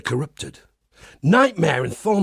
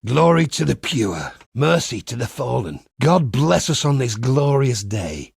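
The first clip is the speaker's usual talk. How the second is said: usual speaking voice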